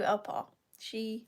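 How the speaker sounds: ordinary talk, nothing marked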